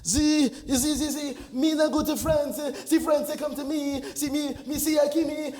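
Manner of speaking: badly faked French accent; broken English in hammed-up mock-French; supposed French accent. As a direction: bluff through in phoney French. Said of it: "is that supposed to be some form of".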